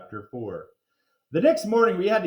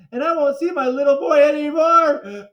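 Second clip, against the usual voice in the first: tearful wail; 10 semitones higher, 11 dB louder than usual. A narrator's reading. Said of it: "Missus Dole started sobbing".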